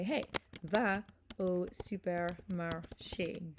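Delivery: slow, carefully spaced French command; deliberate, drawn-out classroom demonstration. Shown as a demonstration. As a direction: give the French order word by word for learners. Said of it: "make that a command".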